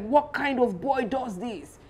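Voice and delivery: a lightly voiced furious king; mock angry king. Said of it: "He's really screaming".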